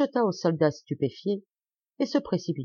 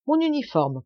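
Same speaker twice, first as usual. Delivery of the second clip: high-pitched curt order; commanding; 8 semitones higher than usual. A narrator's reading. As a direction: order a servant about. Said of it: "commanda monsieur de Sairmeuse".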